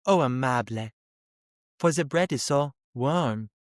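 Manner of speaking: mock-sultry cartoon cat with a French accent; seductive cartoon cat. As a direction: purr it slowly, imitating a French-accented cartoon temptress cat. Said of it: "seductive cat in a cartoon voice".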